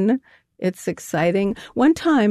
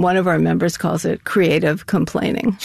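ordinary delivery

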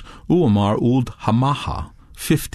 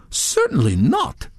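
higher, lightly indignant; indignant denial; 7 semitones higher than usual. A narrator's reading. Touré denying theft to Redbeard, mildly indignant. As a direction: deny it with mild indignation. Accused man denies stealing.

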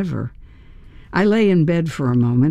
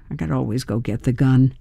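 plain reading voice

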